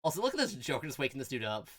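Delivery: ordinary talk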